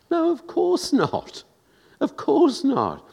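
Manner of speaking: high, slow insistent of course not; high drawn-out rebuttal; emphatic no. Acted. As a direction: sweep up high and lean on course.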